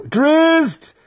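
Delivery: very high, loud, strained cry; exhausted matron cries out; cried name. A narrator's reading. Matron Malice naming her newborn, high strained cry. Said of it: "breathed Matron Malice".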